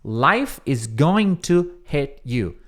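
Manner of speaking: going to said out slowly; slow teaching read; slow full form. Shown as a demonstration. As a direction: spell out the long form. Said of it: "Então, assim, ó".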